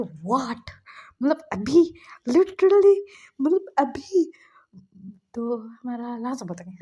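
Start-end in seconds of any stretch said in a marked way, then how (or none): 0.0-4.2 higher, louder incredulous squeak; can't believe he must go right now; boy stunned at being sent out this instant; shocked disbelief. Acted. Shocked at fetching ice cream now.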